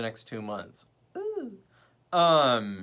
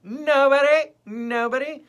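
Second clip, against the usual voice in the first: emphatic nobody; 12 semitones higher, 12 dB louder, slower than usual. Real, not acted. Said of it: "repeated emphatic answer".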